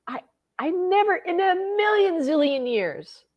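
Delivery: high voice, overcome with feeling; real, deeply touched. Real, not acted. Woman amazed her bully apologized, deeply touched. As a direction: let emotion lift the voice high. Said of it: "still very touched by it".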